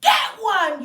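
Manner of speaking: sharp, loud, high yell; child yelling. Acted. Child yelling at her mother, sharp high yell. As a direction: bark it like a furious toddler. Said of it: "yelled Sophie".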